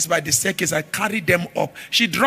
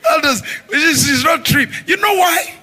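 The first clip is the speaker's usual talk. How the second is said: laughing shout; 8 semitones higher, 5 dB louder than usual. Real, not acted.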